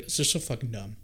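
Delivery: ordinary talk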